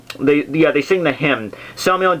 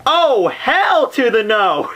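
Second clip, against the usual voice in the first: higher, slow, drawn-out hammed-up outrage; mock Dante flatly refusing the fire; a whiny, hammed-up "hell to the no"; sassy refusal; 7 semitones higher, much slower than usual. Dante refuses to walk through fire.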